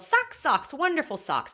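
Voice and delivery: a high, happy cry of socks; child's joyful sing-song shout; excited yell. A narrator's reading. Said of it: "Tina ran home and yelled".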